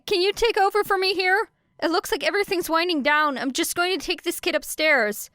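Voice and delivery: put-on a cop trying to calm a kid, clearly out of his depth; officer reassuring the girl, nervous and awkward; panicked police officer. Officer hurries the girl away.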